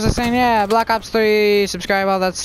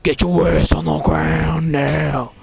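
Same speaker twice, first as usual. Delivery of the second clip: lower, slow cop growl; tough cop voice; 6 semitones lower, slower than usual. Pretend. Gamer doing his cop voice at an enemy, low and slow. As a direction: drag it out low and menacing like a cop. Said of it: "I don't know why I like to do that voice".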